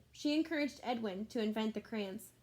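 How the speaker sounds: usual speaking voice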